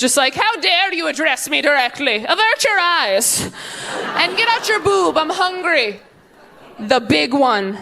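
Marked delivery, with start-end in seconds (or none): 0.3-6.0 put-on imperious baby. Baby voiced as demanding monster.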